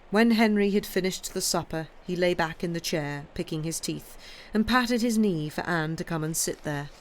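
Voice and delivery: plain reading voice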